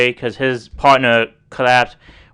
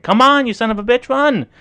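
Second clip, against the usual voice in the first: much higher and yelling like a drill sergeant; 12 semitones higher than usual. Retold or imitated. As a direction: bark orders at a collapsing partner. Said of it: "Talking to him like a jo-, sergeant".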